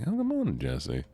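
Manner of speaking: high, singsong good morning; cartoonish squeak of a greeting; squeaky high greeting. Shown as a demonstration. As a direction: chirp it way up high. Greets Jesse again, sing-song.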